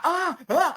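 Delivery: high loud panicky ah sounds; a quick hammed-up panic; mock anxious yelps. Retelling the comedian's stage panic with mock anxious yelps. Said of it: "I could either do that".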